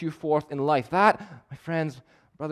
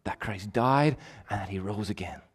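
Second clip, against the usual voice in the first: hushed whisper; 5 semitones lower, 6 dB quieter than usual. Real, not acted. Earnest appeal to congregation.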